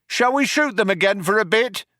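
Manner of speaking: high-pitched calling question; shouted. A narrator's reading. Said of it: "One cop shouted to the other".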